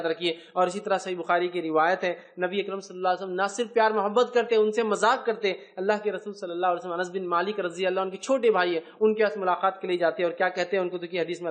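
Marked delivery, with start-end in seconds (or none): none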